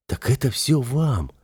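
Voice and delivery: mildly puzzled, drawn-out question. A narrator's reading. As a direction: say it slowly, a bit baffled. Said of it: "озадаченно произнёс я".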